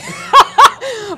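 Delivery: loud, high laugh bursting out; big burst of laughter. Real, not acted. Laughing at the panelist's remark, a loud high burst of laughter. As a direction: crack up laughing at the memory. Laughing at her own retort.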